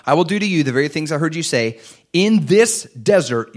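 loud, high pulpit declaration; preaching. Acted. Preacher thundering God's judgment at the congregation. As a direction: proclaim it with full force.

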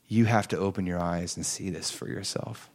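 low, soft urging; hushed awe. Retold or imitated. Passenger urging the son to look, hushed awe. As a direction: urge him gently, voice dropped in awe.

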